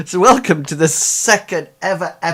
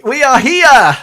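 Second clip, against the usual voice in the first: high, loud, laughing cheer; delighted opening shout; excited announcement; 12 semitones higher, 11 dB louder, slower than usual. Real, not acted. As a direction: burst out triumphantly, much higher and louder. Show opener, laughing call after a hesitant start.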